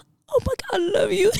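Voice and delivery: very high, touched squeal; retold gushing love. Friend given a surprise drink, high gushing thanks. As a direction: gush as if melting, pitched right up. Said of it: "Jag kände mig så älskad".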